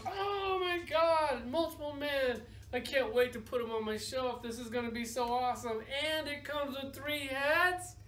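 geek out like a thrilled collector; gushing nerd. Retold or imitated. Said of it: "I was the nerd in there going".

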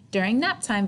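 ordinary delivery

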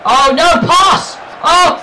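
high, loud urgent cries; panicked gamer shout; frantic shouting. Real, not acted. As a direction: yell desperately for the pass. Urgent play under pressure.

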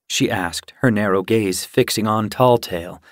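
usual speaking voice

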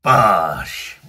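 loud punchy exclamation; loud impact "Bosh.". Real, not acted. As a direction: blurt it out like a hit. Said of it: "The beer just went bosh".

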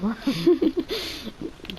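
high-pitched laugh; giggling at his own threat; high giggle. Real, not acted. Laugh after mock threat.

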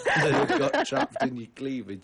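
giggling through the joke; barely holding in laughter; laughing while talking. Real, not acted. Joking about the oil-soaked bird.

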